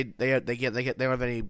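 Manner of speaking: usual speaking voice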